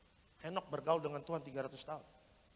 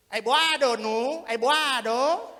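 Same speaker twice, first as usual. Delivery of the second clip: put-on loud, slow, drunken Batak call; drunk Batak greeting; 5 semitones higher, 12 dB louder, much slower than usual. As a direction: hail a friend drunkenly in Batak. Drunk Batak man calls Noah.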